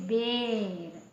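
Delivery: बेर with a long, drawn-out ए; ए की मात्रा का उच्चारण. Shown as a demonstration. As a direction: stretch the ए in बेर slowly. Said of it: "मात्रा के साथ इसका उच्चारण भी निकलता है". (बेर में लंबा खिंचा ए)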